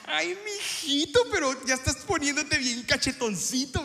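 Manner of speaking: high, drawn-out aunt teasing; blunt aunt voice. Retold or imitated. Aunt teasing her plump nephew at lunch, high and drawn out. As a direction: coo it like a nosy aunt. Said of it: "una tía que no tiene pelos en la lengua".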